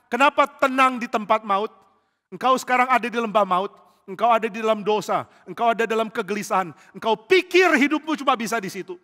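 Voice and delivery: loud, high altar call; fiery preaching. Acted. Preacher calling sinners back to God, fiery and loud. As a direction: urge the congregation to return to God.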